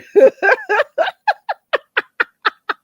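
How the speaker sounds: long, high laughing fit; helpless loud real cackle; uncontrollable laughter. Real, not acted. Cracking herself up over the saddle joke, uncontrollable laughter. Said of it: "Why do I keep laughing".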